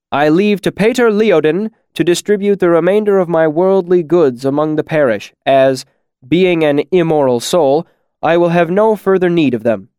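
loud, lofty will reading; grand declaration. A narrator's reading. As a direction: pronounce it with ceremony. Bast dictating will.

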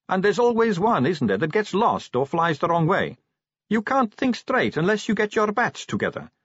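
put-on high, breezy young woman voice; chatty, light-hearted vampire girl. Sally voiced by the narrator.

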